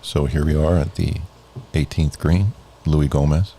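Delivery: low, soft golf commentator; hushed play-by-play on the green. Acted. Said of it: "use your best golf voice".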